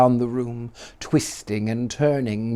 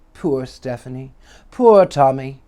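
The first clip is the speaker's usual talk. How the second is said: plain reading voice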